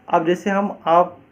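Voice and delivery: usual speaking voice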